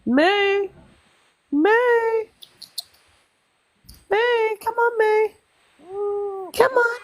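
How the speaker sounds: high sing-song calling voice; calling the cow. Real, not acted. High coaxing call to an animal off-camera.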